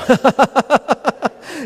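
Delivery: loud high demo laugh; forced laughter. Shown as a demonstration. Leading the audience laugh exercise, loud forced laughter. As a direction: laugh out loud on cue for the crowd. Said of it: "Eight seconds, ready, go".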